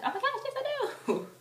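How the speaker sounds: very high, excited retort; teasing squeal. Retold or imitated. Insisting to Taryn she sees him, teasing squeal. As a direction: squeal back in mock insistence.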